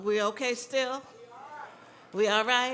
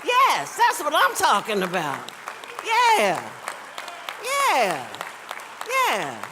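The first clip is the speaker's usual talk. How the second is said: cheering; 6 dB louder than usual. Real, not acted. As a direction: whoop it up for her. Cheering as Jackie stands.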